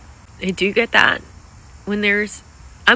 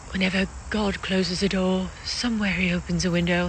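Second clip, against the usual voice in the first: a badly faked old-film accent, a little softer; lightly put-on Sound of Music accent; hammed-up musical-film accent, quoting the line; terrible movie accent; 4 dB quieter than usual. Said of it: "That's a terrible, terrible accent".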